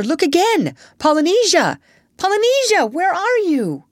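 high, loud searching call. A narrator's reading. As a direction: call out for a missing friend, drawing out the end. Doctor calls for missing parrot.

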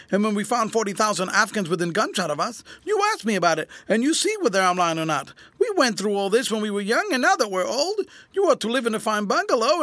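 high, aggrieved whine; whining appeal. A narrator's reading. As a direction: plead insistently, pitched high. Beggar presses his old commander.

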